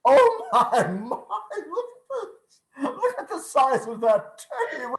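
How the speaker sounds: high, giggly, breathless glee; laughing with delight. Acted. Scrooge marvels at the turkey, laughing with delight. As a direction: laugh it out in giddy joy.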